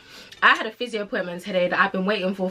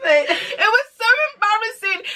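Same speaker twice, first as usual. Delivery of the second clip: near tears; 7 semitones higher, slower than usual. Real, not acted. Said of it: "I'm crying".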